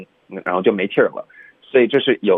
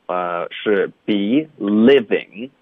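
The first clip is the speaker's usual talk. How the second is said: ordinary delivery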